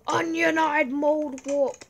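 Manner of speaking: slow, loud hammed-up insult; mock insult. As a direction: spit the silly insult theatrically. Silly cuss then joking.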